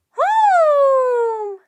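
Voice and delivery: high, loud, long mournful call; unbearably sad wail. A narrator's reading. Octopus longs for the ocean, wailing sadly. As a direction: wail the word from the depths. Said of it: "The sound was unbearably sad".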